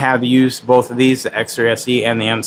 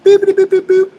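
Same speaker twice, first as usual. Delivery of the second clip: much higher and louder squeak; squeaky boops; 5 dB louder than usual. Real, not acted. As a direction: squeal every boop at the dog. Booping the dog's snoot.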